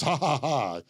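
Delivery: spelt-out "ha, ha, ha" as a lender; mock gloating laugh. Host voicing Loan Daddy laughing at borrowers, mock gloating. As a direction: act out a moneylender's stagy laugh. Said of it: "and he goes".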